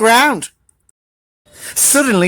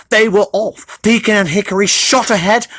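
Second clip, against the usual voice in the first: plain reading voice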